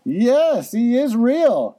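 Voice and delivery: high, drawn-out triumphant confirmation; excited reveal. Real, not acted. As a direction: announce it loudly like a winning answer. Gleeful game-show answer reveal.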